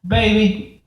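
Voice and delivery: a hint of a flirty Texan lady; playful mock Texan come-on; Texan accent. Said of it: "in her best Texan accent".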